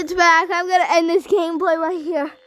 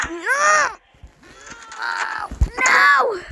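scream; 5 semitones higher than usual. Real, not acted. Scream at jump scare.

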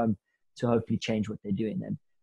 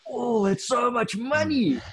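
much higher, slow, panicky inner thought; anxious inner voice; 12 semitones higher, slower than usual. Retold or imitated. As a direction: let the private dread leak out in a high slow whine. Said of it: "inside my heart was like".

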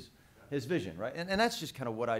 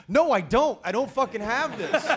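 loud, frantic denial, pitched up; panicked protest; 6 semitones higher, 10 dB louder than usual. Retold or imitated. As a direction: blurt it out in a panic, much louder. Panicking actor protests to co-star.

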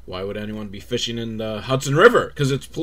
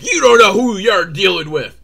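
loud, put-on tough guy; mock threat; 6 semitones higher, 8 dB louder than usual. Fisherman threatening the police, mock tough. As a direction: bluster back at them. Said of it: "the guy's, like, upset".

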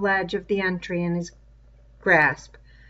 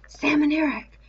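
higher, urgent call; urgent raised call; louder call; 8 semitones higher than usual. Acted. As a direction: call the names up to them, raised and pressing. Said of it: "he must speak louder".

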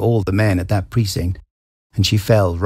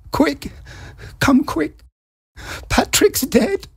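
shrill, very high panicked cry; frantic cry; much slower than usual. A narrator's reading. Wife on the phone to the police, panicked high cry. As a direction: cry for help, much higher. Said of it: "she cried to him".

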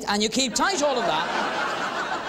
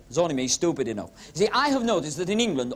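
ordinary delivery

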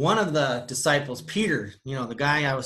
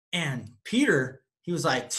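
ordinary delivery

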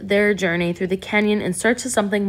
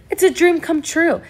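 thrilled, pitched up high and louder; overjoyed; 10 semitones higher, 4 dB louder than usual. A narrator's reading. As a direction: gush with delight at the news. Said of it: "Yvonne said happily".

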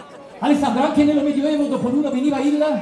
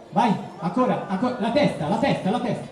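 ordinary talk, nothing marked

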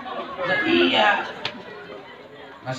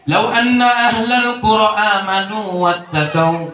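slow melodic Quranic chanting; Quran recitation; slower than usual. Acted. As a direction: chant the verse slowly.

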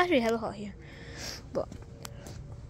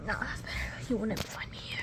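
whisper; 9 dB quieter, faster than usual. Real, not acted. Hiding from the seeker.

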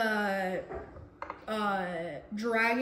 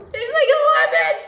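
much higher and louder squeal; high squealed repeat; 14 semitones higher, 11 dB louder, faster than usual. Shown as a demonstration.

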